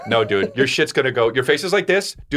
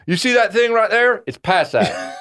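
slow, higher mock old-timer pointing the way; drawn-out, a hint of a geezer; mock old guy giving vague directions; old man giving directions; 6 semitones higher, much slower than usual. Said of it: "you're always gonna say".

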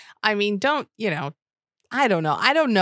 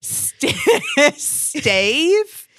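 much higher, louder "Stave?" with a laugh; laughing "Stave?" rising high; baffled Stave; 10 semitones higher, 5 dB louder than usual. Retold or imitated. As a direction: squeak "Stave?" in disbelief. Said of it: "no joke".

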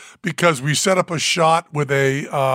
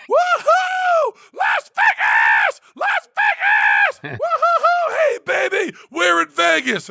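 ecstatic screaming; slower than usual. Retold or imitated. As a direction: scream it like you own Vegas. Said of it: "I start screaming".

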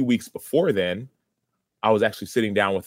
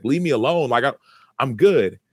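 higher, louder mock brush-off; raised-voice "back off", retold; retold protest; 5 semitones higher, 6 dB louder than usual. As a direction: push them away, as if retelling your protest. Retold pushing back at God's pursuit.

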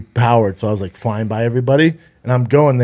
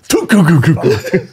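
higher, laughing tuk tuk sound; imitated skidding noise; 5 semitones higher than usual. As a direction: mimic the scooter scraping, laughing.

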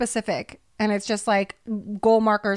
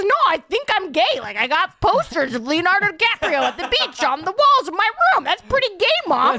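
put-on high, loud whiny kid voice; Pete Davidson impression; 8 semitones higher, 4 dB louder than usual. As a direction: play Pete as a whiny eleven-year-old pleading with mom. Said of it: "Let's hear your Pete Davidson".

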